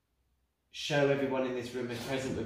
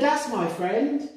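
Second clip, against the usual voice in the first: much higher, louder, slow; spirit's voice; 11 semitones higher, 9 dB louder, much slower than usual. Retold or imitated. Medium announcing the spirit's message to friends, high and loud. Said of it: "He said".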